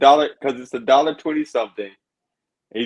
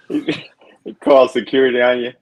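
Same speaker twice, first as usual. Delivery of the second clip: ordinary talk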